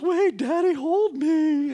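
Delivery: put-on a teen girl wailing at her dad, pitched high; upset daughter. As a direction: play the crying daughter pushing dad away, high and loud. Daughter suddenly wants a hug.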